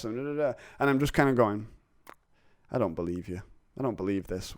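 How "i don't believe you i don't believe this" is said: low, quiet disbelief; soft, low aside to yourself; sceptical inner thought. Retold or imitated. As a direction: think it under your breath, distrusting. Voices own private distrust of boss.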